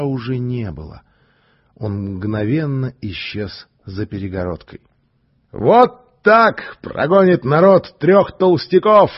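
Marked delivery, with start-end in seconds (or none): 5.5-9.1 slow, loud, higher rallying call; cheerful triumph. Acted. The stranger cheers the crowd on, loud and merry. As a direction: crow it to the crowd. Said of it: "весело сказал негр".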